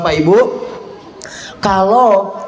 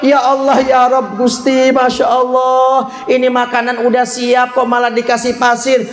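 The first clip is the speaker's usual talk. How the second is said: exasperated mother's prayer; 6 semitones higher than usual. Retold or imitated. Mother finding sand in the food, exasperated high plea. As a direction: wail it as a put-upon mother, voice held high. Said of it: "Yang diucapkan oleh beliau".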